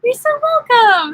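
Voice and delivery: gushing, drawn-out you're so welcome; sing-song thanks. Real, not acted. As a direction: croon the thanks up high and slow. Thanking a viewer for a tip.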